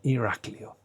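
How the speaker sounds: "Iraklio" stressed on the A. Shown as a demonstration. Teacher confirming the tone mark, stress on the A of "Iraklio". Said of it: "this is on the A".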